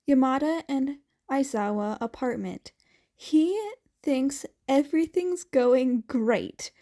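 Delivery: plain reading voice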